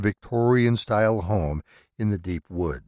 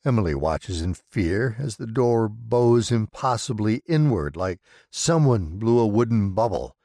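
ordinary delivery, nothing marked

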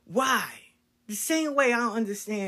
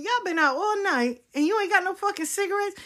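much higher, louder incredulous mama; scolding mother; 12 semitones higher, 4 dB louder than usual. Retold or imitated. Mother scolding daughters home without cigarettes, high incredulous. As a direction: scold the girls like an old-school mom. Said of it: "My mother looked at them and said".

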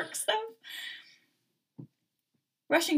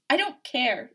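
sharp, louder, defiant retort; raised-pitch, heated dismissal; spat out; 5 semitones higher, 6 dB louder, slower than usual. A narrator's reading. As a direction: spit it out angrily. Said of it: "she spat".

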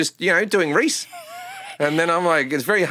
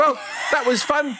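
high-pitched, hammed-up New Zealand accent; confused New Zealander; 11 semitones higher than usual. New Zealand sketch character winding up, New Zealand accent. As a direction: play a baffled Kiwi, voice pitched up. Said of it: "any sketch that involves New Zealanders will go along like this".

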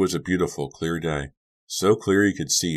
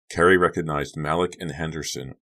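usual speaking voice

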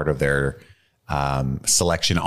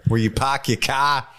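mock Boston accent; 6 semitones higher than usual. Riffing on the Boston accent joke.

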